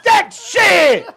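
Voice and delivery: high, loud comic outburst; hyped-up hollering. Acted. Host riffing on Stella's Jamaica trip, hollering. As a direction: shout it out, riled up and playful.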